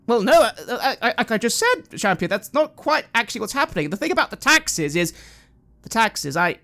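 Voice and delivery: flustered priest. Acted. GM voices the interrupted priest.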